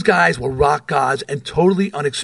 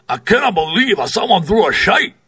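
imitating a thick Scottish brogue, pitched higher; 4 semitones higher than usual. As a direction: do Big John's heavy Scottish brogue. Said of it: "in his thick Scottish brogue".

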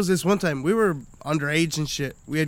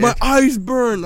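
put-on whiny drunk friend; 6 semitones higher, 6 dB louder, slower than usual. Drunk friend whining again.

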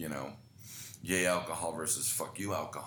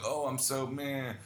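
higher, slow sugary sympathy; mock fawning pity; 7 semitones higher, slower than usual. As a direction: parody people gushing over you. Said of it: "I don't want people fawning".

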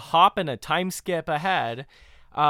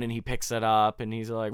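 ordinary talk, nothing marked

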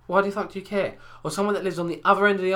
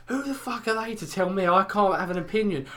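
wound up voice; 6 semitones higher than usual. Retold or imitated. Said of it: "wound up voice".

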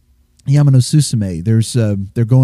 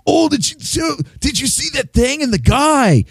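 obnoxious American tourist; 14 semitones higher than usual. Retold or imitated. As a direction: play a grating American tourist. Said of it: "Everybody's trying to sleep".

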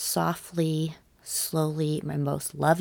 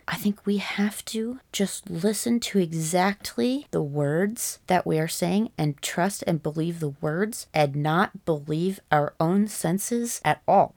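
ordinary talk, nothing marked

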